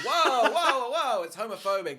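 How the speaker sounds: high, loud string of whoas; mock protest. Character objecting to an insult, loud mock protest. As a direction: cut in loudly with hammed-up objection. Acts out indignant reply to insult.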